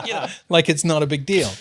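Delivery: real amused laughter in the voice; laughing. Real, not acted. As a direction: talk while still laughing. Laughing at own impression.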